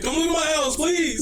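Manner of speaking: high, loud hammed-up plea; mock begging. Cursed man begging the homeless guy, mock desperate. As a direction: beg comically in a squeaky panic.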